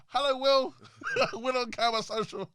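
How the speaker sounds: loud, high call to the cameraman; excited greeting. Real, not acted.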